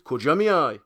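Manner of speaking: high, loud testing question; shouted question. A narrator's reading. Friend checks that departing man knows the place, shouting. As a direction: call it out across the distance.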